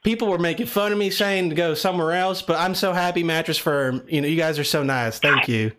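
lightly put-on Southern accent. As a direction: talk like a Southern caller. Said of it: "I like the country accent".

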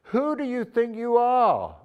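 high, slow, drawn-out challenge; indignant challenge. Acted. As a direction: act out an affronted crowd confronting him.

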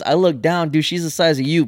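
ordinary talk, nothing marked

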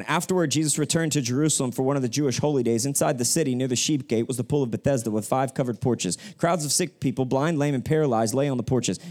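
rapid scripture read; quick read of the Bible passage to the church; reading fast. Shown as a demonstration. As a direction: rattle through the passage quickly. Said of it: "I'm gonna read it fast".

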